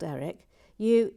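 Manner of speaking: usual speaking voice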